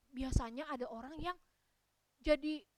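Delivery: plain reading voice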